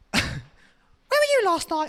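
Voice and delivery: high, slow accusing question; suspicious partner. Retold or imitated. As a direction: drag it out accusingly. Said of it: "you're like".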